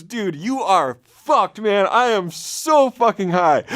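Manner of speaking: a light, lazy stoner telling you you're doomed; stoned roommate. Retold or imitated. Roommate telling the storyteller she is doomed, slow stoned drawl.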